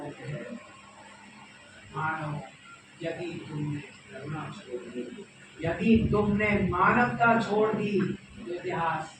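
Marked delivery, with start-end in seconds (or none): 3.0-5.3 softer, measured warning; restrained warning. Acted. First of two parallel warnings. 5.6-8.0 loud, swelling warning; forceful warning. Acted. Second parallel warning, escalated.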